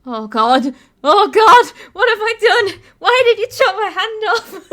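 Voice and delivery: loud, high-pitched acted freak-out with laughter; mock panic. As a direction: act out rising panic, building to a laughing shout. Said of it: "the panic starts setting in".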